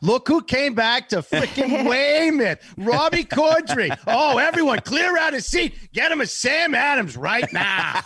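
high, slow, shouting Boston barfly; Boston accent. Retold or imitated. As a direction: hail the returning star Boston style. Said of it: "the Boston accent".

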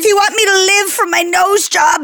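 high, hammy fake French accent. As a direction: plead in an over-the-top fake French accent. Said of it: "I'm gonna do fake French".

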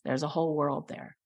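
low, hushed awe in a retold quote; quietly overcome, voicing the vision softly; moved, near tears. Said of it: "And he started to cry".

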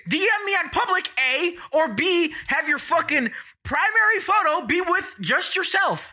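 high-pitched heated rant. Real, not acted. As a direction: vent it all out, fired up. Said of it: "You shouldn't get this heated".

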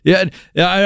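ordinary talk, nothing marked

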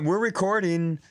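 usual speaking voice

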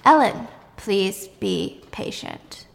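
slow, drawn-out recital; finishing his sentence. Acted. Ellen finishes the policeman's well-worn sentence, slow recital. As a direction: drag each word out, evenly spaced. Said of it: "Ellen finishes it".

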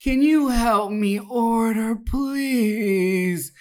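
high, slow, whiny plea for help; moaning needy woman. Retold or imitated. Woman in the next booth begs her date for help ordering, high and slow. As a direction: mimic a strung-out stranger begging for help. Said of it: "she's moaning and groaning like she's in pain".

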